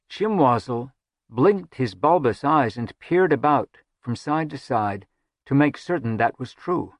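ordinary delivery

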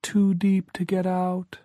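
high, slow and sorrowful; ghostly sad warning; sad, eerie. A narrator's reading. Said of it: "there was a sadness in his voice".

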